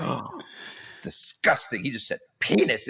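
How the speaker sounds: hushed, gasping disapproval; mock shock, barely voiced; scandalised audience member. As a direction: act appalled in a near-whisper.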